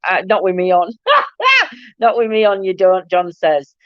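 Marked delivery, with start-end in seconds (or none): 1.0-1.7 short high laugh; real amused giggle; quick high cackle at a comment. Real, not acted.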